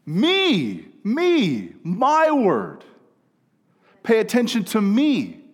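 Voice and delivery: high, loud insistent call. Acted.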